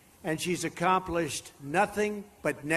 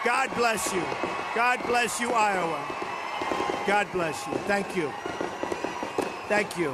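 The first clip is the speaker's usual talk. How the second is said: rally speech. Real, not acted. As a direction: call out thanks over cheering. Closing thanks to rally crowd.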